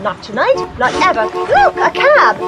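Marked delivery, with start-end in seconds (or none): 1.3-2.3 high, drawn-out excited call; spotting a cab. Acted. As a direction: point it out eagerly.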